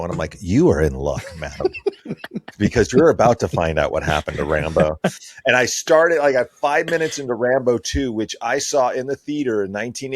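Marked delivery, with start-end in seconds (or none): 0.4-5.0 lower, laughing, lightly voiced showman; a hint of a pompous host; mock grand announcer. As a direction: announce the good news like a butler, chuckling. Dad answers daughter grandly.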